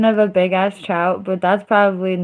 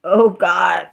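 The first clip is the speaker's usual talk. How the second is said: ordinary talk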